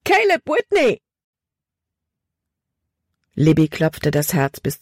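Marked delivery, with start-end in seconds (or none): 0.0-1.0 much higher, frightened cry of his name; girl startled by a voice in the dark, screaming; panicked scream. A narrator's reading. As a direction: shriek it in sudden fright. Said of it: "Von panischer Angst erfüllt, schrie Libby auf".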